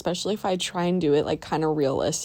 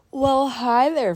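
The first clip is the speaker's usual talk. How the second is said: cheery greeting; 7 semitones higher, 4 dB louder, slower than usual. Real, not acted. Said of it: "Welcome to the voiceover".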